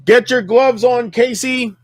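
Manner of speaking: high, loud call to gear up; loud hype call. Real, not acted. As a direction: shout it out to rally Casey. Hyping up friend before hunt.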